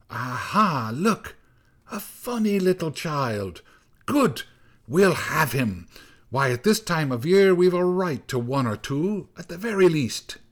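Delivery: high, swooping witchy exclamations; gleeful storm-witch voice; old snow woman. A narrator's reading. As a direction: pounce on a find with relish. Snow woman spots the child.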